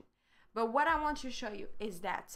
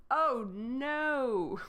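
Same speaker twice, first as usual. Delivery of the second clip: dismayed oh no; 3 semitones higher, 4 dB louder than usual. Real, not acted. Page won't change during live.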